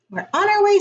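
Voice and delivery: bright and eager; lightly excited Franklin. Acted. Franklin scoops up Snail.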